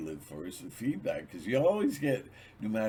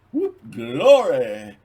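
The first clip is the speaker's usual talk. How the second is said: loud, slow whoop of glee; a loud cheer; jubilant whoop; 5 semitones higher, 16 dB louder, much slower than usual. Acted. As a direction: celebrate it out loud. Jubilant exclamation.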